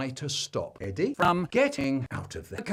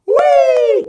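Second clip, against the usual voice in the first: gleeful shout; 17 dB louder than usual. A narrator's reading. Kids dive-bombing bed.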